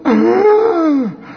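high, loud mock gasp; shocked gasp. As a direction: gasp like horrified onlookers. Acts the shocked onlookers.

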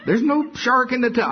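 put-on a parent pleading with a child to bathe; mock parent reassuring. Father coaxing his scared daughter into the tub, pitched high.

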